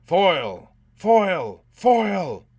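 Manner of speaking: very loud, high shouts; roaring. A narrator's reading. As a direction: roar the name as a hunting mob. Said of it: "The roaring in the hospital grew louder".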